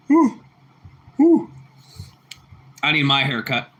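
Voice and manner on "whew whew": high whistle-like whew. Real, not acted. Sudden high exclamations.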